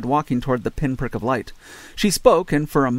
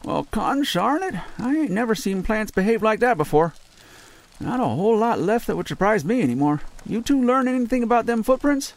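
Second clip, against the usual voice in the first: put-on folksy old man; 9 semitones higher than usual. Old cabin owner marvels at strange plants, high codger voice. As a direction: play the grizzled backwoods cabin owner, amazed.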